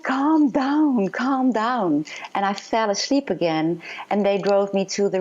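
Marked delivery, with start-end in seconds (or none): 0.0-2.0 higher-pitched retold plea to settle; imitated urgent calming. Nurse to patient screaming after surgery, imitated urgent calming. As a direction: act out a nurse urging calm, pitched up.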